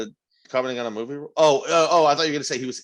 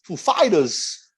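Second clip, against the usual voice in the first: Walken-style word; 4 semitones higher, much slower than usual. Retold or imitated. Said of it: "sounds like a Christopher Walken word".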